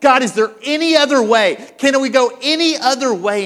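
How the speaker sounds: loud, high, urgent pleading; intense prayer. Acted. Jesus praying in the garden, intense loud pleading. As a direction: plead upward with full force. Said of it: "he's praying so intensely".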